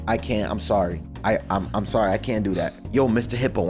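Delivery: ordinary talk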